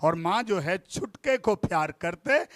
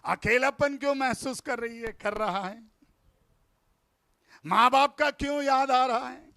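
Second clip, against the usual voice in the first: usual speaking voice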